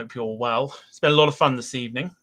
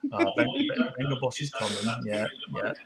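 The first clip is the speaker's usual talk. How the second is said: defeated singer; 5 dB quieter than usual. Retold or imitated. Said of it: "given up on everything and gone".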